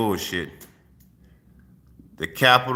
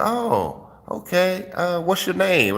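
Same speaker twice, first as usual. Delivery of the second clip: lightly voiced woman's reply, pitched up; higher-pitched retold reply; imitating a woman; 7 semitones higher, slower than usual. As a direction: quote her in a higher voice.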